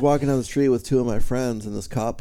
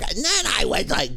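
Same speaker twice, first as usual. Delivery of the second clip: high-pitched caricature of a stage voice; Gilbert Gottfried; 8 semitones higher than usual. Retold or imitated. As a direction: squawk it high like a stage persona. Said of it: "when he's on stage".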